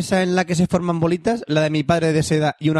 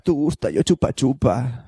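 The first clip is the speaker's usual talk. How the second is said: imitating a movie line; 6 semitones lower, slower than usual. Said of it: "Así es como hablan las putas en Vietnam".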